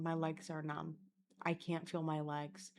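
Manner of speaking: low, soft murmur under the breath; nervous self-talk. Retold or imitated. Walking away nervous from the encounter, quiet muttering. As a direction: murmur it privately, stunned.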